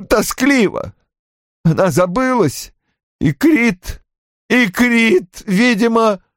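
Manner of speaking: high, halting tearful account; inconsolable sobbing. A narrator's reading. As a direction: stammer through the story in broken, weeping phrases. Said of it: "он безутешно рыдал". (безутешно, высоким прерывистым голосом сквозь слёзы)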